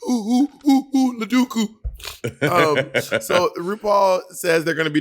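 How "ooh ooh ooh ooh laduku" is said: high silly hoots, much higher; teasing falsetto. Pretend. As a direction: hoot it up high like a cuckoo. Teasing a queen's name.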